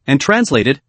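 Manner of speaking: ordinary delivery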